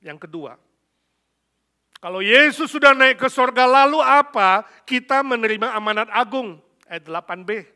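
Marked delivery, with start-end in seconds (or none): 2.0-7.6 loud, high proclamation of scripture; ringing, shouted sermon delivery; full-force preaching. Real, not acted. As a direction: declare it to the whole hall at full volume.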